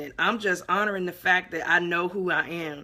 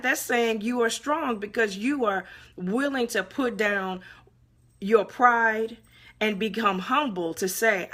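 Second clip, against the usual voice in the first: ordinary talk, nothing marked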